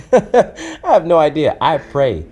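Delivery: high, loud words through laughter; real laughing outburst; laughing admission. Real, not acted. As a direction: admit it while cracking up, drawn out. Laughs at his own honest answer.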